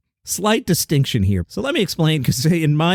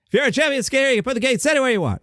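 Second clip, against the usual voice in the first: much higher, fast, exasperated mock-angry read; half-ass angry Jerry Seinfeld; 11 semitones higher, faster than usual. Shown as a demonstration. Host demoing his angry Seinfeld against the flat read. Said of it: "I like to do the angry, half-assed Jerry Seinfeld".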